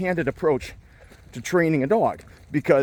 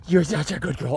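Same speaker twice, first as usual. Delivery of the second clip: ordinary talk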